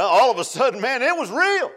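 high, loud, fervent preaching; preaching at full force. Acted. Preacher tells congregation of Paul's revelation, loud fervent high cry. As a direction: build it to a peak.

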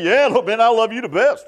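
high-pitched mock boast; bragging. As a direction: brag like an overconfident disciple. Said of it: "afraid to brag and say".

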